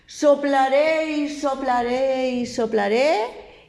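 slow menacing wolf threatening the pig; huffing wolf threat. A narrator's reading. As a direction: stretch every soplaré like a menacing wolf. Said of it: "Y el lobo dijo".

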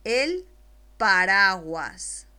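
slow dictation. Shown as a demonstration. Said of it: "después te la dicto".

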